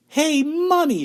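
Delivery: put-on high loud spoilt-girl call; shout. A narrator's reading. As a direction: yell for mummy like a brat. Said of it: "shouted Veruca Salt suddenly".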